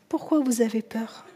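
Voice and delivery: soft, low-key, tender asking; hushed, gentle enquiry to frightened friends; gentle question. Acted. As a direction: ask softly, almost under the breath. Jesus gently questions frightened disciples.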